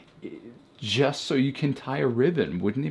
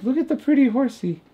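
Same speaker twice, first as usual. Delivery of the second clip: much higher and louder, doting over the horsey; gushing; 7 dB louder, faster than usual. Real, not acted. Fawning to viewers over a pretty horse ornament, high gush. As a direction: coo over it like a favorite toy.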